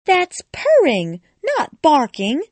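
Alice indignantly setting the cat straight; high, emphatic girlish retort; Alice correcting. A narrator's reading.

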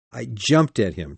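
usual speaking voice

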